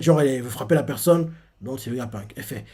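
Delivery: usual speaking voice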